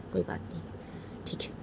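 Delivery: bitter whisper to himself. Acted. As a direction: breathe it out unvoiced. Father repeats it under breath.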